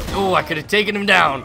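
excited exclamation. Real, not acted. Gamer reacting to a near takedown, excited exclamation. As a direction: exclaim it loudly over the action.

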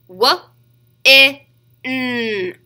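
"W, I, N" as wuh, short i, nnn; sounded out. Shown as a demonstration. Teacher repeating the tapping for children, sounded out. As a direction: pause between each sound of "W, I, N". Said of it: "Do it one more time".

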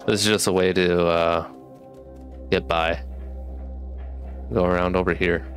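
ordinary delivery

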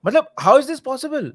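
high, loud, slow disbelief; incredulous. Real, not acted. Football pundit baffled that an old defender plays, incredulous.

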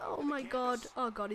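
ordinary talk, nothing marked

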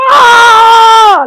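long, very high, loud scream; furious yell. Shown as a demonstration. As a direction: explode in one drawn-out yell. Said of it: "I would have yelled like that".

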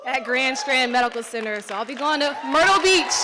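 loud, rising cry of joy; excited shout. Real, not acted. Announcing her residency match to the crowd, excited shout. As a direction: belt it out like the big reveal.

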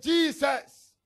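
loud, desperate cry for mercy. Acted. Blind man begging Jesus over the crowd, loud cry.

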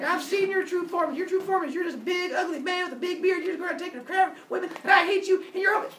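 high-pitched, loud tirade; acted screechy telling-off; furious yelling rant. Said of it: "And just starts yelling".

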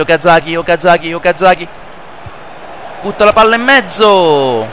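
live play-by-play. Acted. Plays football video game commentating.

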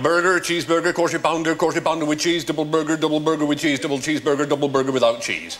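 even, unbroken recital of the menu; rattled-off menu list. Acted. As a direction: reel it off on one level pitch without a break. Reels off the whole menu.

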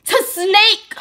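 high loud squeal on snake; disbelieving shriek. Real, not acted. Vlogger recapping her masks to viewers, high disbelieving squeal. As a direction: let the last word burst out in disbelief.

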